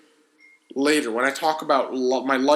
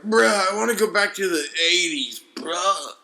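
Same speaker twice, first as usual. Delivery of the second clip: lightly put-on bro voice; mock a nostalgic listener a little; listener's complaint; 3 semitones higher than usual. Host imagining a listener who misses the eighties, lightly mocking. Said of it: "are you like".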